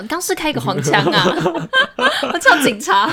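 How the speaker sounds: loud laughter through the words; laughing. Real, not acted. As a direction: laugh while teasing. Teasing joke about dirty remark.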